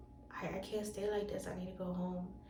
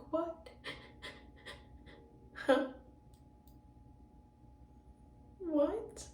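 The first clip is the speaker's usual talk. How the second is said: high, stunned what; shocked what; 8 semitones higher than usual. Retold or imitated. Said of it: "I was like".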